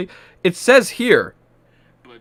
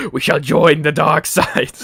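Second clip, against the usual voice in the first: slow, louder mock dark lord proclamation; mock Sith lord; 2 semitones higher, 7 dB louder, slower than usual. Streamer welcomes chat to the dark side, mock Sith voice. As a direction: ham up a hint of evil Sith. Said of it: "Sith aesthetic".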